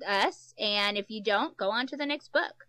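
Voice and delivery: ordinary delivery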